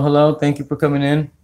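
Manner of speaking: ordinary talk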